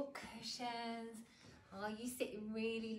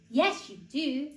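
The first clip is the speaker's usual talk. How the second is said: slow, loud bear voice; insistent bear; 10 dB louder, slower than usual. A narrator's reading. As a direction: insist slowly as a big bear. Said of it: "said the bear".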